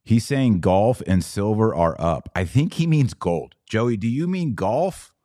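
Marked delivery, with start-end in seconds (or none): none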